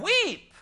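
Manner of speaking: WEEP thrown up high and loud; preacher's ringing climax on weep; emphatic climax. Acted. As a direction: land the last word as the peak. Climax of lament, mourn, weep.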